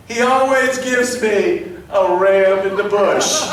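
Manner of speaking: slow, loud, drawn-out declaration; triumphant proclamation. Retold or imitated. Stranger testifies about his God, slow and triumphant. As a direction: proclaim it slowly, ringing out. Climactic answer of the story.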